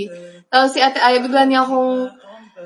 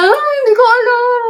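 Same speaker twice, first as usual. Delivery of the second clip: high mock-tearful whimper; crying friend imitated, high and teary; imitating crying; 10 semitones higher, 4 dB louder than usual. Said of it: "sabi niya".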